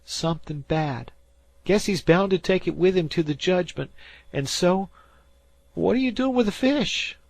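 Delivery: he swears the dead man came back; spooked fisher boy. A narrator's reading. Dan to Harvey, spooked high boy voice.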